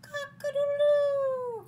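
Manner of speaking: very high, loud, drawn-out crowing; rooster crow. Acted. Rooster calling out to the spider, loud crowing. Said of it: "crowed the rooster".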